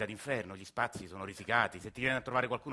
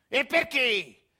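much higher, much louder furious yell; angry shout; 12 semitones higher, 10 dB louder, much slower than usual. Acted. Grandfather yelling at dreaming Agostino, loud furious shout. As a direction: bark him awake, fed up and furious. Old man's angry retort.

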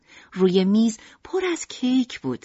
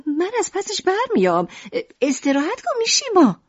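higher, brighter mother's voice; Lucrèce's voice; 10 semitones higher, 3 dB louder than usual. Acted. Mother tells sick Mishima not to worry, in a higher voice. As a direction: lift up into the mother's voice.

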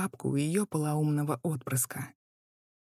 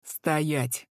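booming command. A narrator's reading. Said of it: "прогремел зычный голос".

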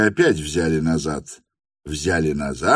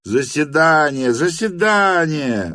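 high, raised cries of assent; calls from everywhere; 9 semitones higher, 4 dB louder than usual. A narrator's reading. Guests vote from all sides, high raised calls. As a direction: call it out twice, the second higher and stretched. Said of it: "раздалось со всех сторон".

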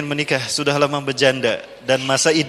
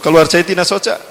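ordinary delivery

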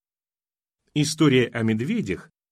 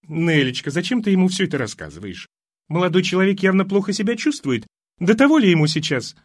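higher, louder doting mother; fussy old mother; 8 semitones higher, 5 dB louder than usual. Acted. Mother scolds her daughter for worrying the young man, higher and louder. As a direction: fuss and protest over him.

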